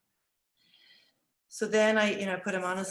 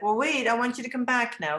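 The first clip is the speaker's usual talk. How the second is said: higher, louder retold call; calling after child; 5 semitones higher, 5 dB louder than usual. Teacher to boy running off, retold calling out.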